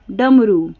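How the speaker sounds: usual speaking voice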